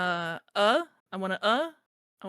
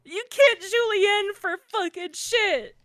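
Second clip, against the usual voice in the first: high, loud furious chef rant; angry chef yelling; 11 semitones higher, 9 dB louder than usual. Pretend. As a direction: scream at a hopeless cook. Chef character yelling at cooks.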